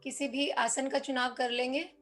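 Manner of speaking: soft, low, unhurried meditation voice; guided meditation. Acted. Teacher guiding students into seated meditation posture, soft and calm. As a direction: settle them gently into posture, pausing between instructions.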